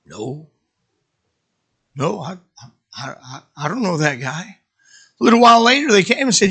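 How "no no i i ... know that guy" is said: nervous denial. Acted. Peter nervously denies knowing Jesus.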